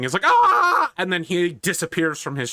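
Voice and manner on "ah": loud, high acted scream; sudden high-pitched yell; Painless Angron. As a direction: scream out on one held cry. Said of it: "Painless Angron begins screaming".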